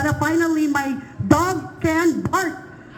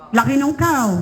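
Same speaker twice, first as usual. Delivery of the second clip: ordinary delivery, nothing marked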